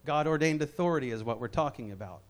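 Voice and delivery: a low, quick echo of the line. Real, not acted. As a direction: drop the voice and run through it quickly. Key phrase said twice.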